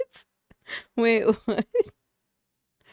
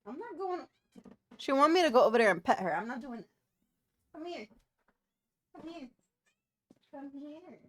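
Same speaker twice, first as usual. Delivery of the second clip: much softer, higher murmured replies; soft refusal to pet; 4 semitones higher, 6 dB quieter than usual. Real, not acted. Refusing to go pet Ruby, soft and high.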